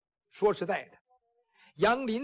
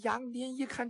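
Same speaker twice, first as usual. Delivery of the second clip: ordinary delivery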